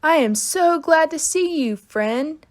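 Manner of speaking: high, bright happy call; joyful shout. Acted. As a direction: burst out with glee. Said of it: "I shouted real joyful".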